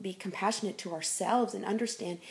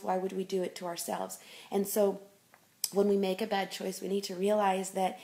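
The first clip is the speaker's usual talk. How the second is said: ordinary delivery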